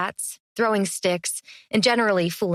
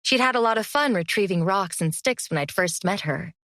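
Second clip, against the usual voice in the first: plain reading voice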